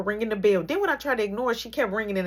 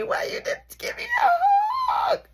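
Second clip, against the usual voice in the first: high hammed-up cry; mock wail. Mock wailing at the girl over the missing hug. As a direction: wail it like a fake tantrum.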